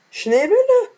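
Schneebälle at the very top of the voice. Acted. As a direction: squeak Schneebälle as high as it goes. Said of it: "ich kann nicht so hoch sprechen".

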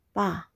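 "pa" short with a drop in pitch; falling tone. Shown as a demonstration. Teacher's third tone example, "pa" falling. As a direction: let "pa" fall quickly. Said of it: "The third tone is a falling tone".

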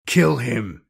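loud roared order; roaring. A narrator's reading. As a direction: roar the command like a warlord. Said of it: "roared the Blood Reaver".

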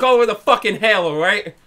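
ordinary delivery

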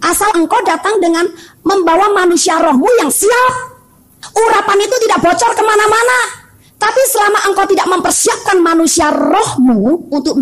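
high, fervent preaching; khotbah berapi-api. Real, not acted. Preacher exhorts congregation at height. (berkhotbah menggebu dengan nada tinggi)

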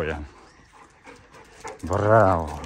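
usual speaking voice

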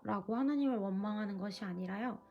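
ordinary talk

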